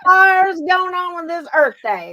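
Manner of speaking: high, loud mock Southern American twang; Southern accent. Telling a friend about Earth Day, in a high, loud Southern accent. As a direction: ham up a Southern American asking what this is. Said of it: "little Southern accent when I speak American".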